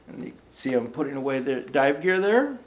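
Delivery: plain reading voice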